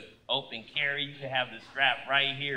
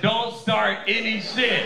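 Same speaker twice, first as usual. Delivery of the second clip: loud, higher, drawn-out warning; retold urgent warning; 5 semitones higher, 5 dB louder, slower than usual. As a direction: warn your girlfriend loudly, slowly.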